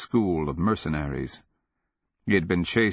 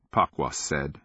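ordinary delivery

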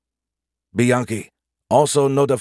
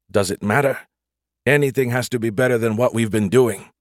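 ordinary delivery, nothing marked

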